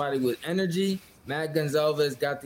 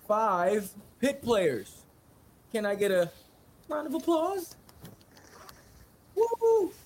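high, slow, cheering hype man; 8 semitones higher, slower than usual. Real, not acted. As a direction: call for applause, rising to a whoop. Hyping up all-ACC selections.

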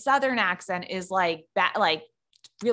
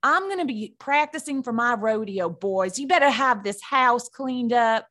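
put-on cowgirl Southern accent. Cowgirl warning the boys about housework, Southern accent. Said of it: "I go real cowgirl".

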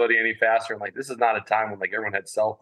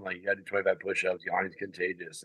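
dropping to a low hush, mid-lecture; hushed aside; 3 semitones lower, 9 dB quieter than usual. Retold or imitated. Telling the guy next to him in lecture, hushed. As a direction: keep it down so the lecturer won't hear. Whispering to classmate during lecture.

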